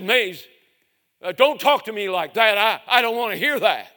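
loud, high, sharp rebuke; angry rebuke. Retold or imitated. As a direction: shut him down hard. Roy snaps at Mays.

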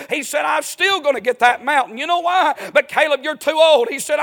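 high, loud shouting sermon climax; fiery preaching. Real, not acted. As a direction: preach it out at full force. Preacher at full force, climax.

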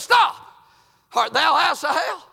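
loud, high shout at a pursuer; shouted challenge. Acted. Pursued Abner turns and challenges.

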